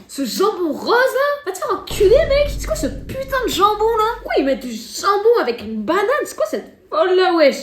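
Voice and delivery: high, hyped-up American rant; American reactor voice. Retold or imitated. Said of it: "imaginez les Français parler comme les Américains".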